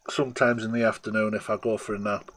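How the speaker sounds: ordinary talk, nothing marked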